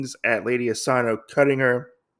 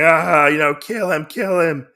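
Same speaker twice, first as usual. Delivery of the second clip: higher-pitched imitation of a gleeful lord; mock happy crowing; 5 semitones higher, 6 dB louder than usual. Oda urges his guards to kill, imitated excited crowing. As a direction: open loud on a mock villain's cry. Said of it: "Oda's very happy".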